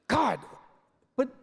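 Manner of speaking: usual speaking voice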